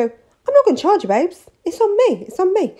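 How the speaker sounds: high, sly first-one's-free voice; dealer's freebie pitch. Retold or imitated. Nail tech giving a free taster like a dealer, high and sly. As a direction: hook them with a generous whisper of a deal. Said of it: "I'd just go".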